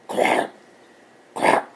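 loud duck-like barking cough; quacking cough. Acted. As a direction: honk it out like a sick dog quacking. Dog's strange cough sounds.

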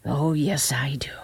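grimly knowing, understated; a touch of dark disapproval. A narrator's reading. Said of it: "she said grimly".

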